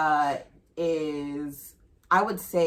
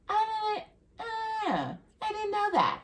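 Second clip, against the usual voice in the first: high, teary mock friend voice; imitating friend. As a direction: quote her high and weepy. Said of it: "she was like".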